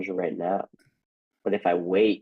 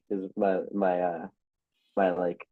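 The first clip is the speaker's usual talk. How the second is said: usual speaking voice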